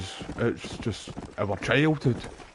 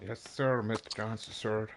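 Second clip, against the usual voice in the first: put-on half-hearted go at a Wild West cowboy accent, trailing off; a quiet, badly faked cowboy accent; failed cowboy accent; 8 dB quieter, slower than usual. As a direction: mimic the game's cowboy accent and botch it. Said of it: "I can't do the accent".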